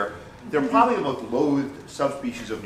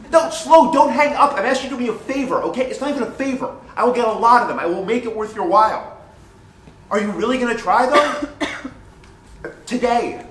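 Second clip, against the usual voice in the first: higher and louder, desperate begging; frantic pleading; 8 semitones higher, 6 dB louder than usual. Acted. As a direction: beg him not to hang up. Pleading on phone with dealer.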